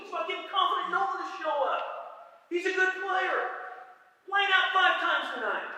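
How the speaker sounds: high, loud, hammed-up coach yell at his player; mock yelling. Coach yelling advice to his slumping star hitter, loud and high. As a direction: bellow it like a fired-up coach. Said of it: "Excuse me, I didn't say that".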